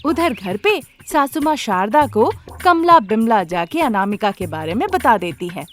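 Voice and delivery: ordinary delivery, nothing marked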